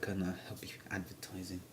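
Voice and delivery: hushed unvoiced whisper. Real, not acted. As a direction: whisper it like a secret aside. Aside while joking about brands.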